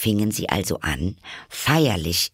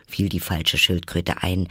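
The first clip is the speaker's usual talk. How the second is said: ordinary delivery, nothing marked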